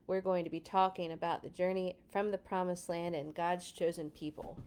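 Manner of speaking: ordinary delivery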